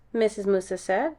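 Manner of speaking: ordinary delivery, nothing marked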